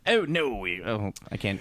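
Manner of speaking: half-hearted try at a British accent; British accent attempt. Shown as a demonstration. Trying out British for the bit, British accent. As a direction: start in mock British, then give up. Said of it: "doing this in a British accent".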